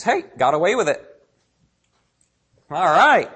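smug, pleased "got away with it"; smug sinner. Retold or imitated. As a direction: sound like you got away with it. Mocking sinner who got away.

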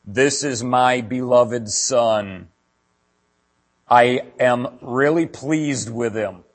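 slow, solemn voice of God from the clouds. Retold or imitated. Pastor voicing God at the baptism, slow and solemn. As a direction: intone it slowly like heaven speaking. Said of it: "God's voice came out of the sky saying".

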